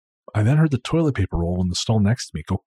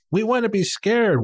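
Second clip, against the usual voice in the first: put-on high, slow little kid voice; 11 semitones higher, slower than usual. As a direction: mimic an eager little kid. Kid quoted wanting scares.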